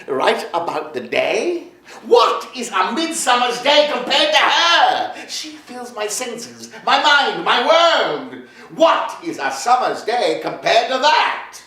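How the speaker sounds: high and loud, swept away by love; lovestruck outburst. Acted. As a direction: pour out the rapture of a smitten poet. Poet alone, overcome with love.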